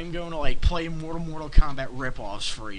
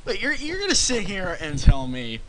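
normal talking voice

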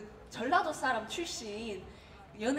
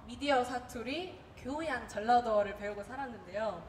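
normal talking voice

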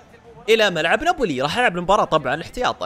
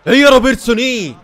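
louder, higher cheer for Robertson; excited shout; 7 semitones higher, 10 dB louder than usual. Real, not acted. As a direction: burst out in a high yell. Same words shouted after plain take. (هتاف عالٍ ومرتفع بحماس)

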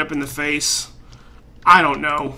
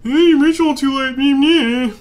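high, loud taunting parody; mock the game in a silly high voice; whiny sing-song jeer; mocking mimicry; 8 semitones higher, 5 dB louder, slower than usual. Mockingly mimics the game's message.

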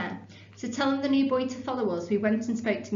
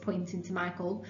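usual speaking voice

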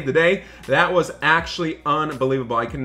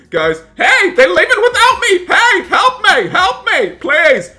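much higher and louder half-laughing cry for help; calling out as they leave without her; desperate yell; 9 dB louder, slower than usual. Acted. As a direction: scream after the leaving boat. Left behind, calling for help.

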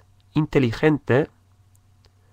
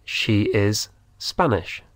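She is Spanish said slowly; slow, clear dictation pace; slow model. Shown as a demonstration. Teacher dictates slowly to Spanish learners. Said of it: "Es española".

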